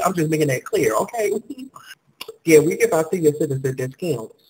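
usual speaking voice

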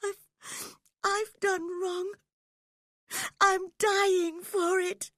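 very high, tearful wail; anguished high-pitched sobbing. A narrator's reading. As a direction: sob out a confession, voice high and loud. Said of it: "sobbed Catherine".

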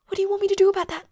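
high, loud protest; exasperated outburst. Acted. Vampire arguing with friend on phone, loudly exasperated.

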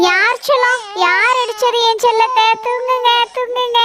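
put-on high cooing to a baby; aunt cooing to a crying baby, high and tender; baby talk. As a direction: coo to soothe the little one.

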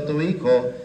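ordinary talk

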